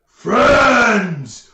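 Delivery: very loud, high ogre bellow; ogre roar. Acted. Ogre answering the player with a smile, roaring. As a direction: bellow it as a big friendly ogre. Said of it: "he says".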